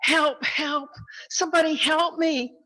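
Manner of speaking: high-pitched mock yell for help; acted cry for help from a joke. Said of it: "he started yelling".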